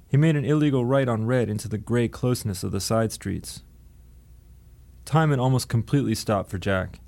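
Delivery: ordinary delivery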